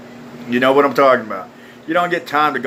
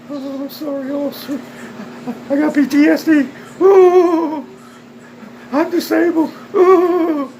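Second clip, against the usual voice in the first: very high, wailing fake sob; mock a crybaby with long high "oh" wails; hammed-up whimpering, swelling into wails; mock crying; 12 semitones higher than usual. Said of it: "These motherfuckers sit there whine and cry".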